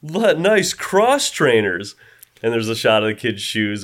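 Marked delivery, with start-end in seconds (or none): 0.0-1.9 high, drawn-out bully voice, lightly put on; mock bully. Bullies spot the kid's shoes, mock bully. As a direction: imitate a punk eyeing the loot, slowly. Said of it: "And they're like".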